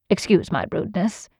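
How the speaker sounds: usual speaking voice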